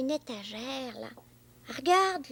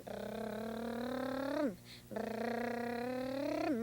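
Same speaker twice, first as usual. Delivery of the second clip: engine noise; 5 semitones lower, 6 dB quieter than usual. Pretend. Boy imitates taxi engine.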